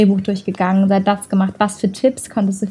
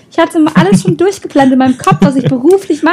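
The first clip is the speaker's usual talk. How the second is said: excited; 6 semitones higher, 5 dB louder than usual. Real, not acted. Dramatic retelling of failed plans.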